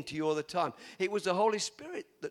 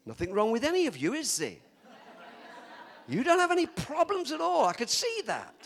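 playful teasing; 4 semitones higher, 5 dB louder than usual. Real, not acted. Preacher teasing congregation.

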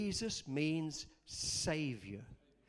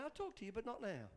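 much softer, understated reply; God answering; 3 semitones higher, 10 dB quieter than usual. Retold or imitated. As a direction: quietly put off an angry complainer. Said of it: "he was just saying".

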